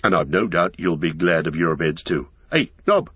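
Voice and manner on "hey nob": high-pitched call to a servant. A narrator's reading. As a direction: call through the door for Nob. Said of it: "he called, going to the door".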